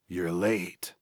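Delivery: soft, throaty jackal figure; a quiet throaty voice out of shadow. A narrator's reading. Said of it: "came a throaty voice".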